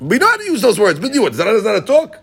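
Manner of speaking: high-pitched talmudic challenge; argumentative objection. Acted. Teacher disputing how the words are used, argumentative. Voicing an objection in study debate.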